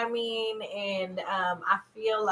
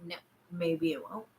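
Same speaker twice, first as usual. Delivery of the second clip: usual speaking voice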